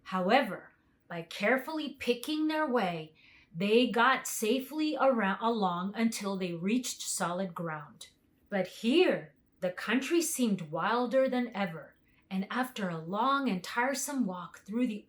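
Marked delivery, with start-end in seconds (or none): none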